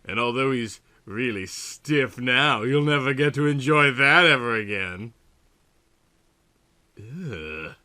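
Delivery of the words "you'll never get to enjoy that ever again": ordinary delivery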